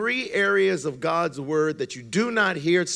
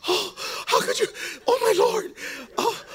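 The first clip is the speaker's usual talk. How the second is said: high, breathy, aghast churchgoer; scandalized gasp. Acted. Church lady horrified at the pastor's answer, scandalized gasp. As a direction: gasp each phrase in hushed horror. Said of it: "And she would go".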